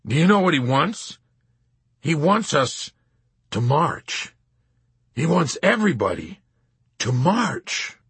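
high, halting disbelief; aghast. A narrator's reading. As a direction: deliver the news in horror, pausing between phrases. Colonel horrified by the phone call.

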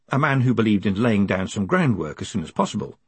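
ordinary delivery

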